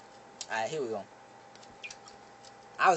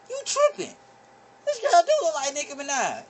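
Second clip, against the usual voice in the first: much higher excited voice; high, thrilled blurt; rushing in, high and amazed; 12 semitones higher, 3 dB louder than usual. Retold or imitated.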